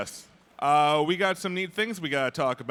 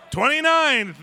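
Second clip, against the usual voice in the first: loud, higher "Twenty-nine!"; a loud, higher, delighted "Twenty-nine!"; excited exclaim; 8 semitones higher, 7 dB louder, slower than usual. Real, not acted. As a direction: burst out with the number. Excited realisation of sum.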